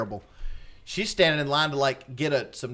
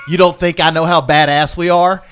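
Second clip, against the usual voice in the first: louder, cocky rocker scoff; snarky retort; 8 dB louder than usual. Retold or imitated. Rocker brushing off a fan, cocky and loud. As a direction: sneer it like a rock star brushing off a fan.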